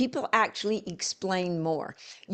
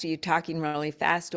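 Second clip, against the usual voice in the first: normal talking voice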